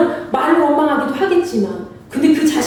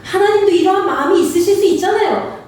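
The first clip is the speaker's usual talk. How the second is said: ordinary talk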